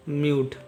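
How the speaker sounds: म्यूट with M gliding into long "yoo"; teacher's model of म्यूट with long YOO; long YOO, silent E. Shown as a demonstration. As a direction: say म्यूट as one syllable, ending on T. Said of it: "यू का उच्चारण होगा यू यानी म्यू".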